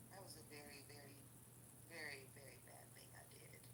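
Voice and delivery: barely there, unvoiced and soft; whispered confession. Real, not acted. To her viewers, whispering a guilty admission. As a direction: hush it like a confession.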